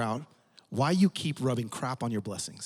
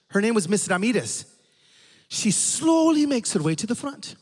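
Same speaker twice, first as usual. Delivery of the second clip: storytelling dad, pitched a bit high; lightly voiced preacher dad; 5 semitones higher, 3 dB louder than usual. Dad telling his son about his first revival, lightly voiced storyteller. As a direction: tell it as a dad savouring his old tale.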